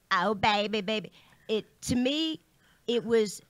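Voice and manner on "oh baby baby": breathy pop-star coo, peach in the mouth. Shown as a demonstration. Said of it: "the way that I imagine [name] is".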